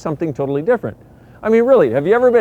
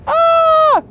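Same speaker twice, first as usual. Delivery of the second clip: very high, louder ah cry; 8 dB louder than usual. Shown as a demonstration. Showing someone else's energy, high, louder ah. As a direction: blast a high ah. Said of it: "somebody else is like".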